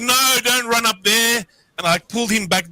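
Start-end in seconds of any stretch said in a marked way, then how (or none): 0.0-1.4 higher, slow plea to the little mantis; retelling how he urged the baby mantis to stay; pleading with the mantis. Retold or imitated. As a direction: call it back, drawn out and higher. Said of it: "I'm like".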